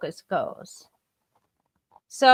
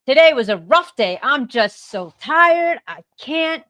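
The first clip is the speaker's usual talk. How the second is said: exasperated; 3 semitones higher, 8 dB louder than usual. Shown as a demonstration. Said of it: "I can say".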